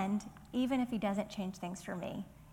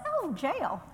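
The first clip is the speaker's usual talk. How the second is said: higher-pitched, playful southern lilt; southern accent; 8 semitones higher than usual. Shown as a demonstration. Teacher mocking her own pronunciation of Jael, southern accent. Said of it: "my southern accent is like".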